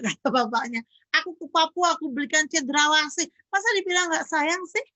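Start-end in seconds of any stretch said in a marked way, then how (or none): 1.2-4.8 high, incredulous mock complaint; acted indignant husband pleading his case; protesting husband. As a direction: build to an aggrieved, higher-pitched question.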